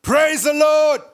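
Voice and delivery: loud, high shouted praise; joyful shout. Acted. Elderly woman shouting praise from her porch, loud. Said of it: "and she would shout".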